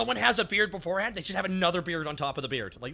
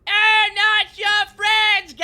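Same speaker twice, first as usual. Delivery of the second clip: very high, loud, stretched-out cartoon yell; mock quarrel scream; 14 semitones higher, 14 dB louder, much slower than usual. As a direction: hold each word in a long mock scream. Acted quarrel at its peak.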